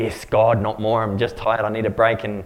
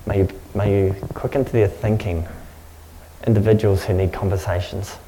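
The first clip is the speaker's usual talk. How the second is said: earnest, lower prayer voice, unhurried; 5 semitones lower than usual. Real, not acted. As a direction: pray over them with steady conviction. Pastor prays over congregation.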